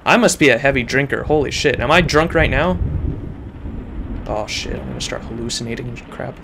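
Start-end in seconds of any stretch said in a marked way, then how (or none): none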